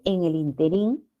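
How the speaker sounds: interín stressed on the last syllable, rising; interín said as in-te-RÍN; final stress. Shown as a demonstration. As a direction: lift and lengthen the RÍN in interín. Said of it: "si se pronuncia así".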